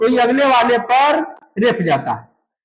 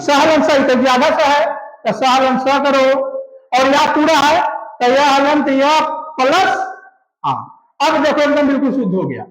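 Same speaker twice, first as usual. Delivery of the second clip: normal talking voice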